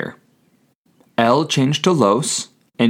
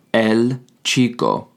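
slow repeat; slower than usual. Shown as a demonstration. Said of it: "you say".

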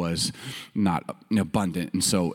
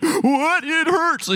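high-pitched, hammed-up childish alarm; mock panic; 4 dB louder, much slower than usual. Boy convinced he has an ulcer, high mock panic. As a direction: play a worrier's panic for laughs.

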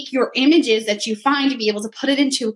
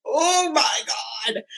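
slow, stunned "oh my God" at the price; shocked gasp; much slower than usual. Retold or imitated. Seeing the dream house price, a shocked retold gasp. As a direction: let it drop out of you, floored. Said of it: "I went".

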